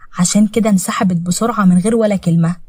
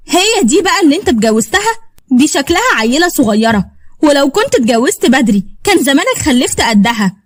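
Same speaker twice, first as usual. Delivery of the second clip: high, swooping scornful questioning; jealous woman's haughty jibe; scornful jealousy; 5 semitones higher than usual. Acted. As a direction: sneer at a younger rival in a lifted pitch. Jealous rival mocks young wife.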